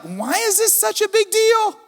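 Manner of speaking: high, drawn-out mock bewilderment; baffled questioning. Relative dragged into an old family feud, mock baffled. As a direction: stretch it out in a put-on high voice.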